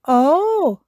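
high, loud little oh; Susie, surprised. A narrator's reading. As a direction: let the oh rise as the idea lands.

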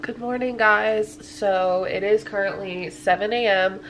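ordinary delivery

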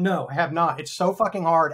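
ordinary talk, nothing marked

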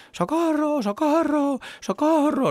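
high-pitched "socorro" cries repeated; cries for help. Acted. Trapped people calling down to firefighters, high desperate cries. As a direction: cry out from the burning tenth floor.

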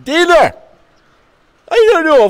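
high, loud call to another room. Real, not acted. Irish player yells to family about dinner, loud high call. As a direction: yell through the house.

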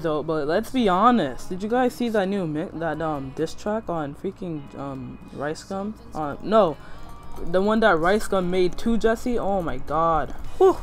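ordinary delivery

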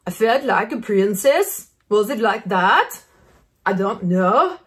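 slow, mock Norwegian accent, lightly done; a light imitation of a Norwegian woman's accent. Quoting the Tinder swindler victims in a slow mock Norwegian accent. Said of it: "whatever their Norwegian accent was".

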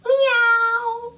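cat meow. Pretend. Cat impression.

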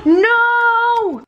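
high, loud cry of horror; horrified no. Real, not acted. Reacting to how rough she looks, a high loud horrified cry. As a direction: cry out in dismay at the sight. Sees herself on camera.

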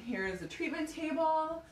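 ordinary delivery

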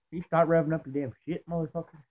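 usual speaking voice

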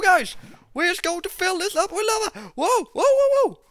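high, frantic cries; panicked alarm. Real, not acted. Gamer fleeing spreading lava, high panicky shouting. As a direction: yelp and whoa in rapid bursts.

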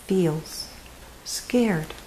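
soft, dropping whisper of scared; hushed refrain. A narrator's reading. Reading to children, hushing that bear feels scared. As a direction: let the words fade into fear.

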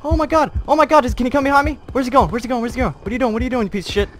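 high, loud frantic yelling; frantic panic. Real, not acted. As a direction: panic as the enemy closes in.

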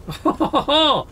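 a high giggle of delight; gleeful high laugh. Real, not acted. Gamer laughing at the upgraded village, gleeful high laugh. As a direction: laugh with glee at the result.

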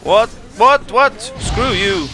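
high, loud bursts of disbelief; indignant gamer outburst; startled shouting. Real, not acted. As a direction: yelp it in disbelief, then hit back. Reacts to surprise attack in game.

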